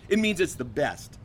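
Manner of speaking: ordinary delivery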